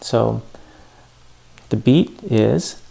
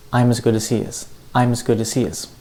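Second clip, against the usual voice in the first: quick, linked "I'm as good as he is" with reduced "as"; unstressed words faster; faster than usual. Shown as a demonstration. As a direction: keep the beat on "I'm", "good", "he", squeeze "as" and "is". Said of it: "say the unstressed words a lot faster".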